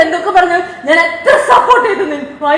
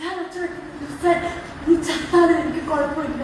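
suddenly much softer after the outburst; hurt, subdued; 3 semitones lower, 11 dB quieter, much faster than usual. Real, not acted. Daughter quoting her father's hurtful words back, quiet and hurt. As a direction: drop the voice and recall it quietly.

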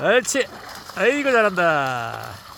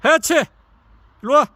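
calling out; 6 semitones higher, 5 dB louder, faster than usual. Real, not acted. Calling dog by name.

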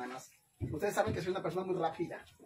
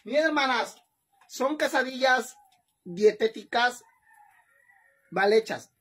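loud; 5 semitones higher, 6 dB louder than usual. Real, not acted. Proud presentation to viewers.

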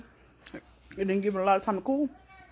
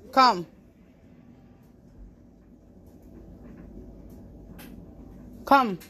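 stern command; 5 dB quieter than usual. Real, not acted. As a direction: order him like a strict aunt. Ordering the toddler to bed.

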